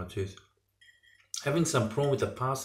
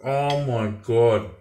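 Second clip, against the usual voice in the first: very slow, drawn-out exclamation; savouring awe; 3 dB louder, much slower than usual. Real, not acted. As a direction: stretch each word over a delicious mouthful. Final reaction to the dish.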